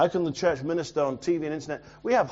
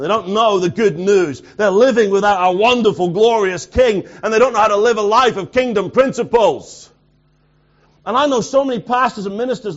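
raised, forceful sermon delivery; impassioned preaching; 6 semitones higher, 7 dB louder than usual. Real, not acted. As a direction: preach it out loud to the whole room.

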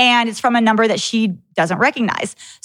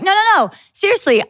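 high, quick no no no, brushing them off; sassy comeback; 9 semitones higher, faster than usual. Retold or imitated. [name] firing back at the radio DJs, high sassy retort. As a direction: toss it back with mock outrage, pitched way up. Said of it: "And I'm like".

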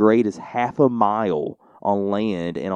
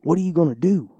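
imitated Cajun lady, higher and louder; Cajun accent; 8 semitones higher, 8 dB louder than usual. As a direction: play her Louisiana accent as a question to the kids. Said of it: "a very strange accent".

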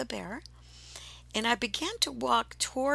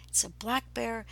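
ordinary delivery

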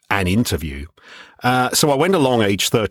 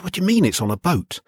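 high, quick disbelieving question; a little boy who can't believe it; high, fast, baffled kid; amazed child; 8 semitones higher, faster than usual. Retold or imitated. Child amazed at radio on boat.

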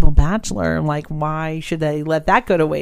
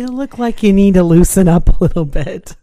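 bar stranger; 9 dB louder than usual. Retold or imitated. Stranger at the bar to tense woman, pushy. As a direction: play a pushy stranger, louder. Acts out the stranger's pickup line.

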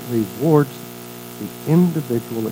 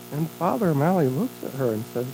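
ordinary delivery

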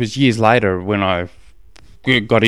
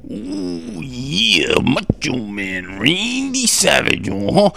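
hammed-up wrestler voice with drawn-out words and big pitch swoops; swooping mock wrestler promo; Macho Man Randy Savage; 6 semitones higher, slower than usual. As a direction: put on a bad wrestler impression, stretching and sliding.

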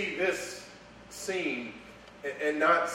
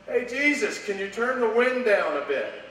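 high, louder, with a laugh; mock request; 7 semitones higher, 6 dB louder than usual. Preacher mocking a frightened disciple's prayer, high with a laugh. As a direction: playfully ask Jesus to fix the weather. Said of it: "what is gonna be your first request?".

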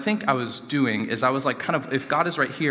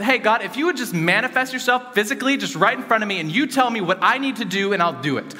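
frustrated yelling; 7 semitones higher than usual. Retold or imitated. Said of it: "I just started yelling at him because I was frustrated".

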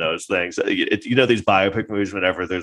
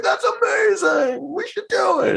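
very high, slow, shouted mock gush; gushing exec; slower than usual. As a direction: overplay a producer sold on nothing. Mocking execs instantly on board.

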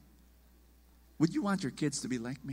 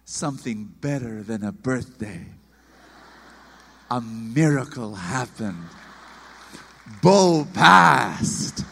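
lower, then a loud proud burst; mother announcing the news, lower, then a loud burst; mom's announcement; 8 semitones lower, 7 dB louder, slower than usual. Retold or imitated. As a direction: mom building to a proud cry. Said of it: "my mom would say".

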